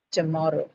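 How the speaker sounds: tomorrow with the stress on the second syllable, to-MOR-row. Shown as a demonstration. Teacher showing the class the stress in tomorrow, stressed second syllable. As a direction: lean on the middle of tomorrow. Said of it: "it actually has on the second one".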